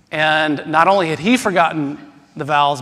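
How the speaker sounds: ordinary talk, nothing marked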